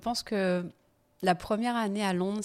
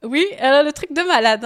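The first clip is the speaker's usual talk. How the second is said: much louder and higher, thrilled; excited; 8 semitones higher, 14 dB louder than usual. Real, not acted. Telling the interviewer about the big law firm, loudly thrilled. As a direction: burst out with amazement. Marvelling at landing top job.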